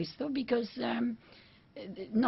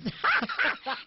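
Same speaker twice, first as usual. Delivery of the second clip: laughing; 3 dB louder, slower than usual. Real, not acted. Laughing at elocution story.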